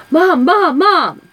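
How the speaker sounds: high, loud urgent calls of Mom; calling out to mom. Retold or imitated. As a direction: call out like a boy running into the house. Son bursting in, calling mom.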